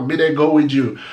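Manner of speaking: ordinary talk, nothing marked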